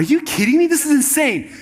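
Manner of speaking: high-pitched, incredulous protest; mock-astonished, voice jumping up; acted disbelief. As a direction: re-enact being floored by a crazy plan. Acting his disbelief at the plan.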